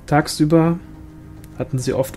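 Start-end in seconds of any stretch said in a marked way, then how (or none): none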